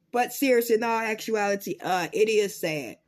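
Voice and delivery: normal talking voice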